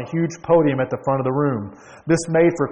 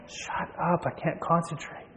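much softer, half-whispered protest; student's stifled complaint; hushed annoyance; 2 semitones higher, 11 dB quieter, slower than usual. Acted. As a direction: grumble it under your breath. Student muttering at the shouting professor.

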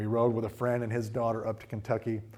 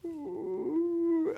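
very high whimpering ooh; sick groan. Retold or imitated. A daughter groaning in the car near Kentucky. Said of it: "Emily's like".

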